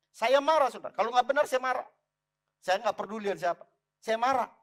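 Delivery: emphatic anger. Shown as a demonstration. Declares his anger, voice raised high. (menyatakan marah dengan lantang)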